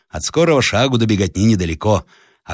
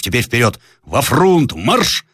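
rising, higher march orders; commanding shout; 5 semitones higher, slower than usual. Acted. Captain to the square, shouted march order. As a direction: drive the men forward.

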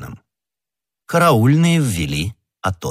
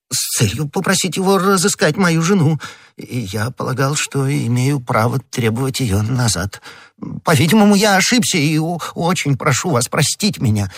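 higher-pitched, pleading little man; flustered apology in a raised pitch; anxious, apologetic prisoner; 7 semitones higher than usual. Acted. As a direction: plead your case nervously to the interrogator. Bonacieux pleads under questioning.

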